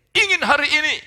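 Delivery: sudden loud, high pulpit cry; fervent shout. Real, not acted. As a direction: burst out with the declaration at full volume. Preacher suddenly raises a fervent declaration. (seruan khotbah yang lantang)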